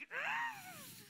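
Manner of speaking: long, high-pitched groan of impatience; exasperated groan. Acted. Stuck behind a slow person at checkout, exasperated groan. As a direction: let out a drawn-out, high frustrated ugh.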